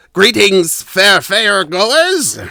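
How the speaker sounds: loud, high, drawn-out goblin greeting; elderly goblin calling out. Acted. Elderly goblin hails visitors from the ticket counter, calling out. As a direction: call out slowly and grandly as an old goblin. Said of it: "calls out to you".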